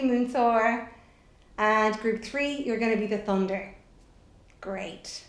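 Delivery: normal talking voice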